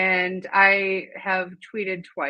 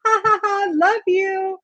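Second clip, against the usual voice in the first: mock cheerful; 11 semitones higher, 4 dB louder than usual. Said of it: "So then I was like".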